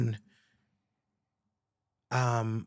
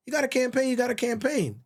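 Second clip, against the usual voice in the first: others urging; 9 semitones higher, 4 dB louder than usual. Retold or imitated. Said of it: "they keep saying".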